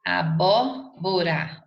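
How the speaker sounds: "abóbora" slowly split, BÓ stressed; slow syllables in "abóbora", punching the BÓ; stress on antepenultimate syllable. Shown as a demonstration. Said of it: "a sílaba tônica é a antepenúltima".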